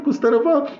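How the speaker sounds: ordinary talk, nothing marked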